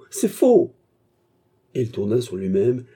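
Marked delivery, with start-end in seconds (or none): 0.1-0.7 C'est faux! pushed even higher and louder, near a shriek; the last frantic denial at full force; desperate shriek. A narrator's reading. Fernand denying the theft, shrieking. Accused man protests innocence.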